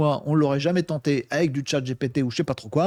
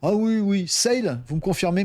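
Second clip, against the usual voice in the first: normal talking voice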